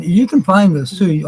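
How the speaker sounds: normal talking voice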